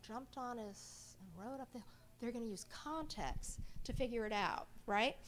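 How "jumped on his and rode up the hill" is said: quieter read with a gap where "bicycle" goes; word left out. Shown as a demonstration. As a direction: skip the missing word and read on softly. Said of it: "So they're gonna automatically know".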